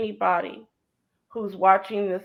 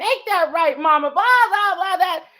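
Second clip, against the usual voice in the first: playful gossip; 9 semitones higher, 5 dB louder than usual. Retold or imitated. Retelling her teenage gossiping with grandma.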